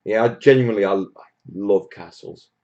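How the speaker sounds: normal talking voice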